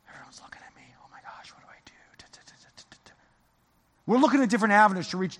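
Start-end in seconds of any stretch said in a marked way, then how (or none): 0.1-1.9 whispered, anxious inner voice of a newcomer; nervous whisper. Retold or imitated. Pastor voicing a nervous churchgoer's panic in a whisper. 2.2-3.1 breathy whispered da da da; whispered filler. Retold or imitated. Pastor whispering a newcomer's nervous mumble.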